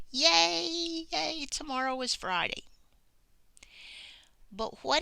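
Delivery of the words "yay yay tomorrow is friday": high, bright-eyed yay, tomorrow is Friday; excited cheer. Shown as a demonstration. Teacher showing a happy Friday, excited cheer. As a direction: cheer it like the weekend's here. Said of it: "So I would probably say".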